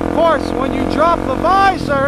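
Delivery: muffled through a helmet mic over wind; helmet mic while riding. Real, not acted. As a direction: talk from inside a helmet on the road.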